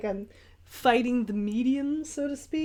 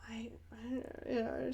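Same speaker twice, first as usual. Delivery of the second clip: stunned, barely voiced whisper; retold speechless whisper; baffled whisper; 10 dB quieter, slower than usual. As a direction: whisper it, lost for words. Stunned reply to gallery visitor.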